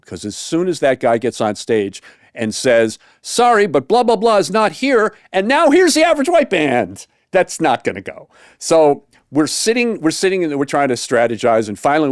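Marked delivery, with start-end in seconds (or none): 3.2-7.1 higher, announcer-style mock call. Imagined MC telling the crowd the headliner's gone, higher announcer voice. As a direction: sell it like a stage MC.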